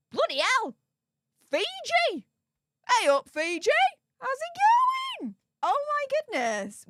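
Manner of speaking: high-pitched, astonished greeting; thrilled, amazed squeal; real amazement. Real, not acted. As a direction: exclaim in high-voiced disbelief. Amazed at viewer from Fiji.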